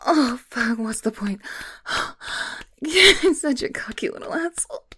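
usual speaking voice

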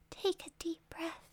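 normal talking voice

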